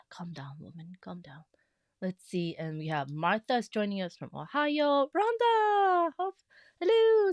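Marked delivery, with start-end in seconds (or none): 0.1-1.4 very soft whisper, telling herself to calm down; whispered self-calming. Real, not acted. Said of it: "I'm getting all excited and out of breath". 5.2-7.3 excited call. Real, not acted. Greeting a viewer, calling out.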